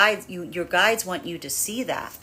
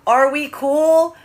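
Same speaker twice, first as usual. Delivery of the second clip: much higher, louder and slow; drawn-out question; 10 semitones higher, 9 dB louder, much slower than usual. Acted. Feminine asking her higher self if all is well, high and slow. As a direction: stretch it out, hopeful and high.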